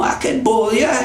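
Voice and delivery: high, relishing list of threats; threatening Brer Fox, gleeful. Acted. Fox weighs punishments aloud, high and gleeful.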